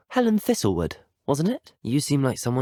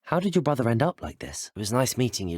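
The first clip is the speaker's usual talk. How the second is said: ordinary delivery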